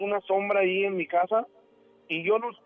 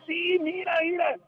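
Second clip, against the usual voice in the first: higher, whimpering child imitation; scared child; 10 semitones higher, slower than usual. Son telling dad the figure scares him, in a high frightened child voice. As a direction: play the frightened boy begging dad to look. Son insisting, retold quote.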